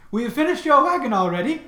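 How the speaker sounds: high lady voice; man doing a woman's voice. Acted. GM voicing the cart lady.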